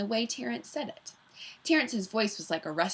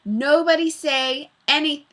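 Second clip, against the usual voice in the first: loud, urgent warning; girl warns the class, loud and urgent; 7 semitones higher, 10 dB louder than usual. Acted. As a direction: warn them all before it's too late. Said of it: "Jenny warned".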